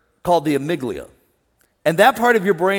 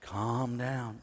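much softer, low soothing calm down; 6 semitones lower, 15 dB quieter than usual. Shown as a demonstration. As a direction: ease them down gently. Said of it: "So I gotta calm down".

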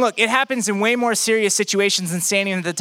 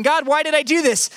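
exasperated cry; 7 semitones higher than usual. Acted. Desperate cry to God.

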